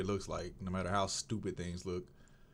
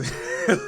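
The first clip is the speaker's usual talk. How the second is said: short high laugh, much louder; real laugh; 9 semitones higher, 9 dB louder than usual. Real, not acted. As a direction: laugh out at your own joke. Laughing at own retelling.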